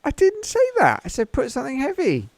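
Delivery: high-pitched indignant protest; mock outrage. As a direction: squeal the denial in outrage. Protesting in checkout voice.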